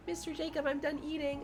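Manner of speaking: high, soft imitation of a small child; little girl. Preschool girl telling her teacher she's done, in a high little voice. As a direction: put on a tiny, polite preschooler voice. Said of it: "she'll, like, be like".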